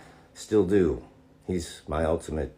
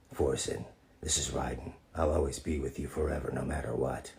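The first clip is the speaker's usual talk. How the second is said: tender request read; 7 semitones lower, 10 dB quieter than usual. Pretend. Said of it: "Can you say something along the lines".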